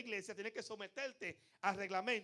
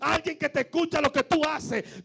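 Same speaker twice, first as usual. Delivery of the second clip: loud, rousing preacher's call, louder than his talk; preaching at full force; 3 semitones higher, 12 dB louder than usual. Acted. Pastor calling out to his congregation, preaching at full force. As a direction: proclaim it to the whole congregation.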